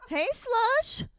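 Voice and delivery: very high-pitched teasing call; high mock catcall. Man hailing a brunette on the street, high mock catcall.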